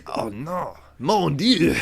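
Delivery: high, loud hammy French cry; French accent. Pretend. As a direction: wail it like an outraged Frenchman. Mock French exclamation.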